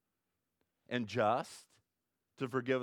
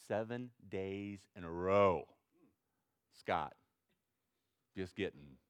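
ordinary delivery

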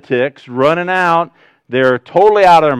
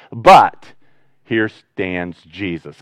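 usual speaking voice

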